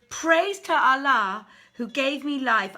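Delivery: triumphant king. Acted. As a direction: proclaim it like a victorious king. King exults after slaying the slave.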